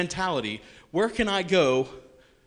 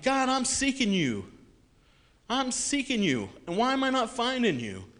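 much higher, urgent pleading; desperate plea; 9 semitones higher, 4 dB louder than usual. Retold or imitated. Seeker pleading with God, higher and urgent. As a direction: beg for an answer.

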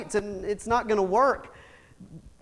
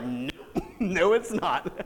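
softer, laughing through a sheepish no; laughing admission; 4 semitones lower, 7 dB quieter than usual. Retold or imitated. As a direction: chuckle as you concede it. Laughing at himself in the rain.

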